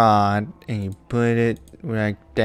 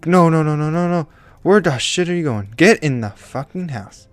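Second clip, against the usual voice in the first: angry yelling; 6 semitones higher, 5 dB louder than usual. Real, not acted. Yelling at runaway pig.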